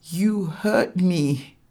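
self-pitying whimper; pitiful and teary. Acted. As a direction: bleat it like a wounded child. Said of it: "His voice was pitiful and teary".

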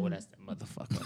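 hushed whisper of recognition; whispered realization. Retold or imitated. Recognizing the ex beside her, whispered realization. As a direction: breathe it out, stunned.

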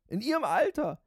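high, loud pompous disbelief; boastful. A narrator's reading. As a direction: lord it over the listener. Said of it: "wiederholte er großspurig".

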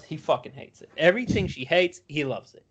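normal talking voice

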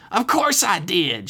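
high, drawn-out retort; mother snapping back. A narrator's reading. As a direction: snap it back in a put-on motherly voice. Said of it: "she snapped back".

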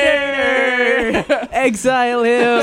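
high loud laughing accusation; hammed-up call for banishment; mock outrage.

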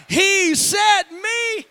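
high, very loud and drawn-out cry; shouting the climax to the congregation; triumphant shout. Real, not acted. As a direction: stretch "me" slowly then belt "free". Climax of the sermon.

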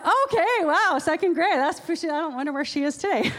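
a big startled "okay" that tails off; real amazement at a second-grader's comeback; amused shock. Real, not acted. Reacting to a shocking story.

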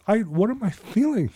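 high-pitched retold tearful bewilderment; mock bawling. Man retelling bawling after pulling away, mock tearful bewilderment. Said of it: "I literally just start bawling".

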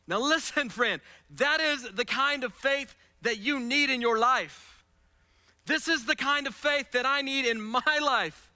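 usual speaking voice

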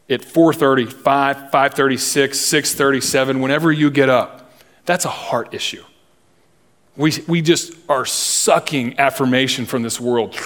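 ordinary talk, nothing marked

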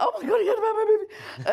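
overjoyed he knows her film; giddy disbelief. Retold or imitated. Director thrilled Bruce heard of her film, giddy fast gush. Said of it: "And I was like".

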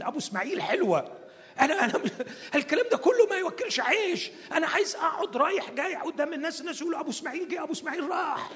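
high, fast, laughing everyman whine; comic Abraham rant. Acted. Abraham telling God he just wants a son, high and comic. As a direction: play Abraham pleading like a regular guy.

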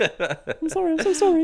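high pleading sorry, hammed up; high whiny apology.

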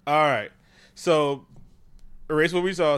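ordinary talk

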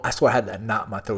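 normal talking voice

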